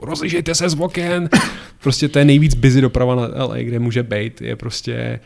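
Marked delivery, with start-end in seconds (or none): 0.1-1.3 raised-pitch imitation of a shouted order; mock shout. Pilot to passengers with no radio, mock shouting. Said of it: "takový křiky typu".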